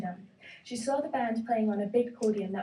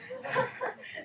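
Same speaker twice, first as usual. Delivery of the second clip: high laugh; 14 semitones higher, 4 dB quieter than usual. Acted. Elephant laugh.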